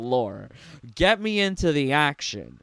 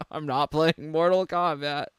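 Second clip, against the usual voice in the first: ordinary delivery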